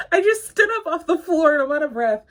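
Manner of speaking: fast, laughing through the words; laughing breathlessly. Real, not acted. Amused at herself for being out of breath. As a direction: giggle at being winded. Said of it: "Why am I out of breath".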